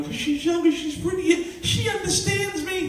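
a preacher mimicking a husband who leaves his wife for a younger woman, in a high sing-song mock. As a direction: parody his excuse in a lilting, high voice. Mocking a straying husband's excuses.

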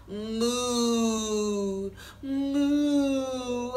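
long drawn-out mooing; cow moo. Pretend. Reading a farm book to kids, mooing like a cow. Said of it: "that's the sound the cow makes".

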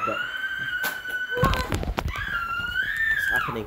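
drawn-out shrieking shout; panicked shout. Acted. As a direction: scream it out slowly in panic. Patient wakes, panic.

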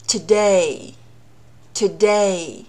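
today with DAY stressed and drawn out; light "to", long strong "DAY" in today; second-syllable stress. Shown as a demonstration. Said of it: "重音落到第二个音节上".

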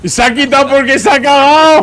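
high, loud gleeful yell; excited shout. Real, not acted. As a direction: crow it out loud. Mocking enemy, shouted.

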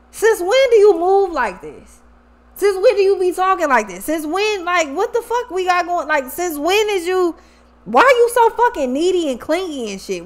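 acted-out indignant challenge; exasperated confrontation. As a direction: imitate someone fed up, demanding an explanation. Voices the partner's exasperated complaints.

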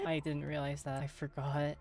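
ordinary talk

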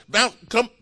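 loud, high coach's yell to get up; shouting coach. Retold or imitated. Football coach yelling at a hurt receiver, loud. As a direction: bark it like a football coach on the sideline. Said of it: "the coach would say".

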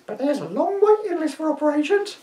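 high, creaky crone voice. Acted. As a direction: croak it like an old hag. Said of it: "in my crone voice".

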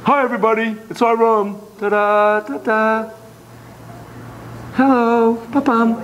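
a touch of a squeaky doll; lightly voiced toy saying hi. Voicing doll in room.

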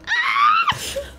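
very high shrill shriek; terrified scream. Real, not acted. Player jumped by the creature, shrill terrified scream. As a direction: scream in pure fright. Scared by monster.

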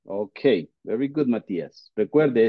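normal talking voice

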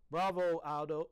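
loud, high-pitched cheer; loud squeak. A narrator's reading. Small pet applauding the custodian's trick, squeaking loudly. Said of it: "I squeaked, and as loudly as I could".

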